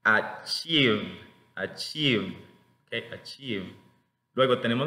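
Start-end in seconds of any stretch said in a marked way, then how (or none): 0.0-2.4 "achieve" with a stretched smiling ee; careful model of "achieve", long vowel; long I demo. Shown as a demonstration. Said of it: "sonriendo cuando hacen estas articulaciones". 3.1-3.8 "Achieve" softer, with a long smiling ee; soft model of "Achieve", long vowel; long I demo. Shown as a demonstration. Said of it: "sonriendo cuando hacen estas articulaciones".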